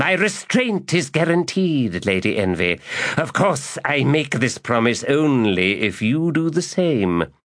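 high, sly and wheedling; sly promise. Acted. Magus bargaining with the lady, high and sly. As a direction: promise with a catch.

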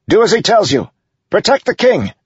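high, loud order to soldiers; yelled. A narrator's reading. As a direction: yell urgently at your men. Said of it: "he yelled at his men".

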